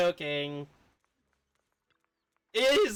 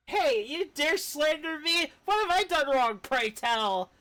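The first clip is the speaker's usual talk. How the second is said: higher, hammed-up indignant retort; indignant character; 10 semitones higher than usual. Streamer voicing the accused character, mock indignant. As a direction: act offended, pitch it up. Character protests being blamed.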